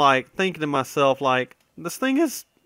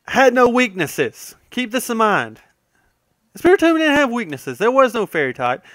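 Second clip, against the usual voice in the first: higher and louder, leaning hard on the point; emphatic excitement; 6 semitones higher, 4 dB louder than usual. Real, not acted. Ranting to viewers about Spiritomb, pitched up and louder. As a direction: drive it home like it still amazes you. Stressing Spiritomb had no weaknesses.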